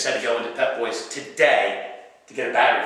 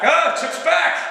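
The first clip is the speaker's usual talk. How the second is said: very high, loud cheer; high loud happy call; excited shout; 5 dB louder, slower than usual. Retold or imitated. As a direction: cheer that he made it back. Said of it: "others call out".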